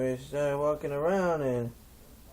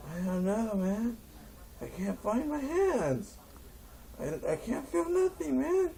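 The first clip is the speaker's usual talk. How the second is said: whining, climbing ever higher; high stoner whine; 11 semitones higher than usual. Retold or imitated. Stoner whines to the chief, climbing higher. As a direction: whine in rising stoned panic.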